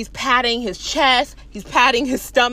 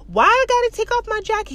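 high-pitched mock protest; indignant protest; 8 semitones higher than usual. Man objecting to guard about the jacket, indignant. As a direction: protest it high, acting affronted.

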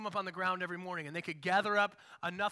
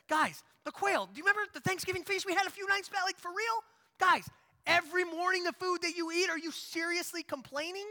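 higher, fast exasperated disbelief; incredulous rant; 7 semitones higher than usual. Retold or imitated. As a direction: plead with them in disbelief. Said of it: "I'd be like".

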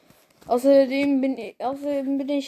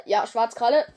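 plain reading voice

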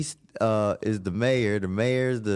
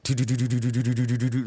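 imitated heartbeat; much faster than usual. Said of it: "like a damn Uzi going off".

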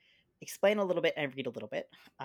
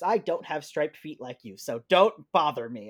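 mildly grumpy, louder storybook walrus, slowing at the end; lightly acted irritated walrus; 8 dB louder than usual. A narrator's reading. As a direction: tell the little one off with a touch of grump. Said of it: "grumped Missus Walrus".

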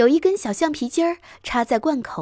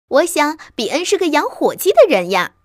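put-on high, loud child's protest; puzzled little fox; 5 semitones higher, 6 dB louder than usual. A narrator's reading. Little fox wondering where turkeys are, puzzled child voice. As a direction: puzzle aloud like a small child.

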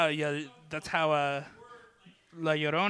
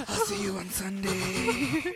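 tender; 6 semitones higher, slower than usual. Pretend. Mimicking the same.

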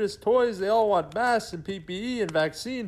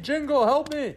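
higher-pitched cry for help; flustered plea; 6 semitones higher than usual. Acted. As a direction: plead with your helper, all worked up. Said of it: "Santa, calm down".

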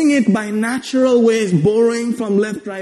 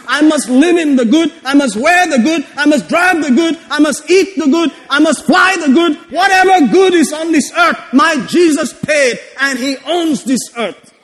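higher, driving declarations, louder on key words; full-force preaching; 6 semitones higher, 4 dB louder than usual. Acted. Preacher declaring God's promises to congregation, full-force preaching. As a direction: preach it as a rousing claim.

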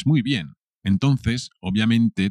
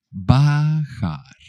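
BAJAR stretched out syllable by syllable, higher; higher, elongated teaching take of Bajar; drawn-out word; 4 semitones higher, 3 dB louder than usual. Shown as a demonstration. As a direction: say Bajar slowly, holding each vowel for learners.

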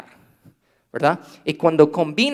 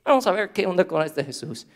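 mock offhand; 4 semitones higher than usual. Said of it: "No es".

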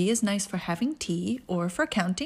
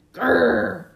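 dog growl; 8 dB louder than usual. Acted.

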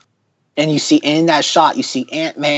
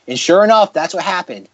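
ordinary delivery

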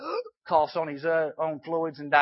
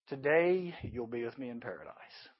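low, soft reassuring voice; gentle promise; 8 semitones lower, 12 dB quieter than usual. Acted. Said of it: "And Jesus says".

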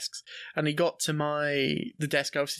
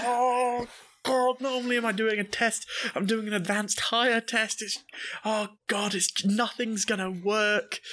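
high-pitched, dragged-out panicked lament; mock despair; 6 semitones higher, slower than usual. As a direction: wail in mock dread at being doomed. Dread at facing advanced test.

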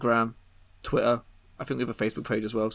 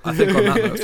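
higher, louder to cut the chatter off; louder insistence; 9 semitones higher, 11 dB louder than usual. Real, not acted. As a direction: raise the voice to shut the joke down. Repeats louder to cut them off.

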